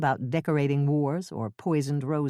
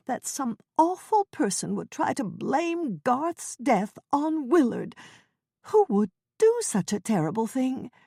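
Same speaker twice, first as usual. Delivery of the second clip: put-on Ethel's fretful old voice; 8 semitones higher than usual. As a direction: quaver it like a flustered old neighbor. Ethel voiced in cozy mystery.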